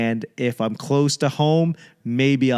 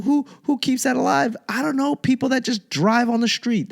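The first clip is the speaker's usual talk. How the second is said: lightly higher scoffing voice; mocking skeptic; 11 semitones higher than usual. Comic mimicking critics asking who keeps Arby's alive. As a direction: sneer at the fast food chain.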